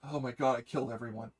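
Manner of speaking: low, haunted mock confession; Amuro. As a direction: sink low into mock horror. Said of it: "And he goes".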